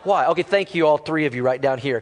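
ordinary delivery

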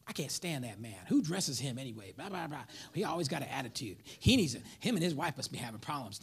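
hushed, low gossip about the boss; gossiping coworkers. Retold or imitated. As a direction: keep it low, grumbling behind his back.

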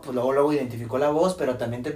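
usual speaking voice

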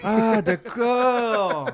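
drawn-out realisation. Real, not acted. Player finally understands the game's rule, drawn-out. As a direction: stretch it out as the penny drops.

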